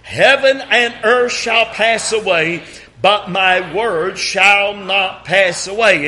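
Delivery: slow, measured scripture reading, in step with the congregation; unhurried congregational recitation; slow group reading. Shown as a demonstration. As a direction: read it aloud slowly together. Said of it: "read it with me".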